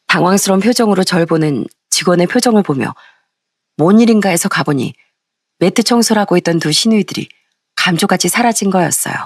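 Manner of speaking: ordinary delivery, nothing marked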